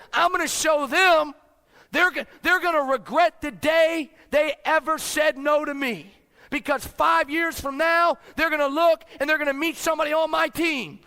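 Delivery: loud, high, all-out shouting; fired-up shouting. Pretend. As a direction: shout it like a promise of revenge. Fired-up imagined future dialogue shouted.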